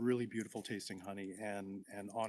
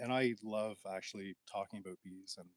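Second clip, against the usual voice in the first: normal talking voice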